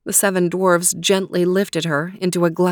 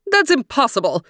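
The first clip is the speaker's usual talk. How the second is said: screaming queen; 6 semitones higher than usual. Acted. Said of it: "screamed the queen".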